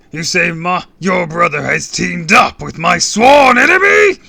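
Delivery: very loud, high, outraged question; furious roar. A narrator's reading. Villain outraged at the brother's supposed betrayal, roaring. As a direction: bellow like a furious beast. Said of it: "He roared like a furious beast".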